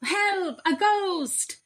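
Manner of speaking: high, panicked cry; terrified yell. A narrator's reading. Thieves fleeing the ghost, crying out high in panic. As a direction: scream it up high in fright. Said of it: "yelled Len and Hugh".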